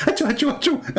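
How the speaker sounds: high squeaky mock sneezes; hammed-up sneezing; fake sneezes.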